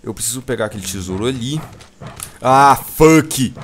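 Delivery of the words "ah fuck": loud sudden curse; swearing out loud. Real, not acted. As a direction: curse loudly at the hit. Gets hit in game.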